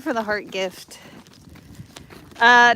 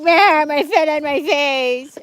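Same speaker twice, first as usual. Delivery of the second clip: slow, loud, higher mock whine; goofy voiced complaint; 6 semitones higher, 8 dB louder than usual. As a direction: whine it like a clumsy dog. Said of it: "He's like".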